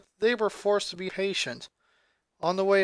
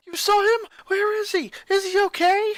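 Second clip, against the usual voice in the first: high, loud, urgent pleading; frantic questions; 14 semitones higher, 6 dB louder than usual. Acted. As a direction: fire the questions desperately while shaking her. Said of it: "shook her vigorously".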